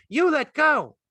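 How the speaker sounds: very high, loud demand; alarmed cry. A narrator's reading. Curly's wife struggles free of Lenny, loud cry. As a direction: cry out sharply at the top of the voice. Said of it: "she cried".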